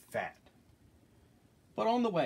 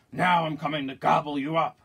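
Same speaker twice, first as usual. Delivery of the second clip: loud, raised troll threat; 5 semitones higher, 9 dB louder than usual. Acted. Troll coming for the big goat, loud menacing voice. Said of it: "said the troll".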